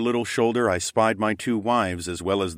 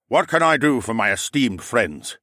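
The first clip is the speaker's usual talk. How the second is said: put-on courtly old uncle; 4 semitones higher, 4 dB louder than usual.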